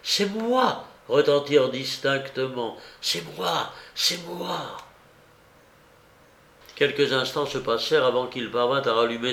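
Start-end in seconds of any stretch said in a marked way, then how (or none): 0.0-0.9 C'est moi set higher, clear and ringing; first phantom call. A narrator's reading. The phantom announcing itself to Aratov, higher. 3.0-4.8 softer, fading repetition of C'est moi; ghostly echo. A narrator's reading. Phantom voice echoing to Aratov, softer and fading.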